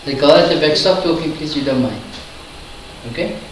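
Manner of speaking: low, quiet stern warning; firm teacher reprimand, getting colder; calm turning angry. Real, not acted. Teacher scolds a talking student.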